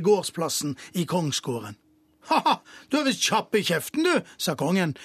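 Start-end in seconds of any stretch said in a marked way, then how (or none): 2.3-4.3 quick, higher, chuckling retort; mild laughing king; amused king. A narrator's reading. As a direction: tease him with a laugh in the voice. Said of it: "begynte å le".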